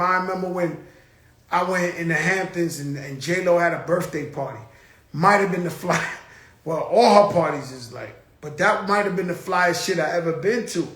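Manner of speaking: ordinary talk, nothing marked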